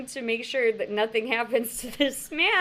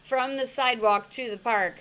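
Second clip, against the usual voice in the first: usual speaking voice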